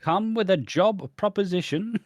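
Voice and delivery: high, swooping mock-posh voice; posh accent. Answering the posh pimp's offer, mock-posh accent. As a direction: put on lofty posh English. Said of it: "I want him to be posh".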